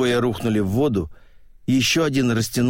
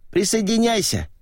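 calling out; 11 semitones higher than usual. Acted. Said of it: "подал голос Зиганшин".

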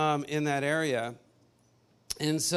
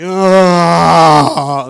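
a long, very loud mock moan; imitated loud moan; 20 dB louder than usual. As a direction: belt out a ghostly moan, hammed up. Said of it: "then he starts moaning, like, really loudly".

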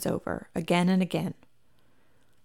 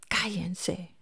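softer whispered hush; hoarse whisper; 7 dB quieter than usual. Acted. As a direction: hush them, voice rough from drink. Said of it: "Lupe tiene la voz ronca".

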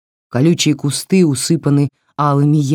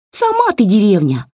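higher, louder indignant doll; flaring doll; 7 semitones higher, 4 dB louder than usual. Acted. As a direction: flare up like an offended doll. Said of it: "взвилась Васька". (вспыльчивый писк куклы)

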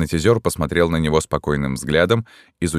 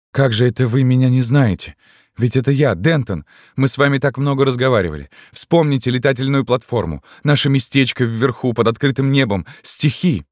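high, urgent appeal; bewildered pleading; 8 semitones higher than usual. A narrator's reading. Lover reminds her of their times together, bewildered. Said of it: "сказал Дентон растерянно".

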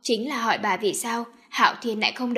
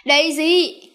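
loud, higher call after her; 5 semitones higher, 9 dB louder than usual. Acted. Mother calling after the girl as she runs out.

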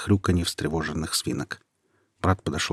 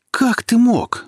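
high, loud accusing shriek; furious scream; 7 dB louder, much slower than usual. A narrator's reading. Nika screams at Quentin in fury, high loud shriek. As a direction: scream it at the boy who betrayed her. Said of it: "закричала она".

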